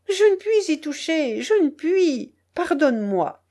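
high pleading voice, dropping at the end; anguished refusal. A narrator's reading. Peasant cannot touch the money, pleading in anguish. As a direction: refuse helplessly, begging.

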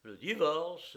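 loud indignant outburst; indignant cry. A narrator's reading. Friend shocked at her divorce idea, indignant cry. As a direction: throw the word back in outrage. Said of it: "m'écriai-je avec une telle indignation".

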